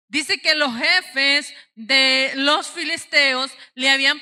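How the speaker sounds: loud, high pulpit delivery; forceful preaching. Real, not acted. Preacher recounting the Philistines' bribe, forceful preaching. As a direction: drive each phrase out to the whole room.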